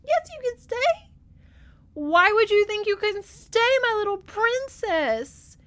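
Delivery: mock sob the reassurance; teary falsetto. Weeping war hero telling his niece she can stay, mock teary falsetto. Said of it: "He starts tearing up".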